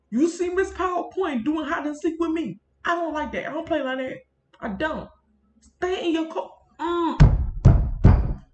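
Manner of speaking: high, loud mock telling-off; mock scolding. Vlogger telling off PowerPoint for hiding, mock scolding. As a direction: scold the program like a naughty child.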